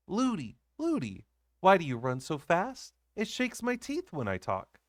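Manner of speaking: put-on high, bumpy child's complaint; a princess shaken as she runs; jolted little girl. A narrator's reading. Child jolted while running.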